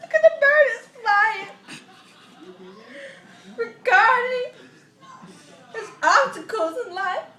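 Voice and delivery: fake-weep the story line, building up; hammed-up crying. Reading a kids' bird book aloud, building into mock sobs.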